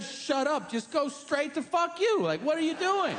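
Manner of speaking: high, exasperated rant; mock outrage. Comic argues with the shusher, high mock outrage. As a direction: climb high in comic indignation.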